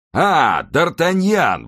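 loud, high, drawn-out hail; exclaiming. A narrator's reading. Porthos spots D'Artagnan in the street, exclaiming loudly. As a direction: call out slowly and high to a friend. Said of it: "воскликнул он".

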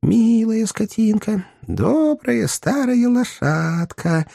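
high, slow sing-song coaxing; ingratiating. A narrator's reading. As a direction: sweet-talk a horse in a high, drawn-out voice. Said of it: "вкрадчиво сказал мистер Уинкль". (вкрадчиво, высоким голосом, нараспев)

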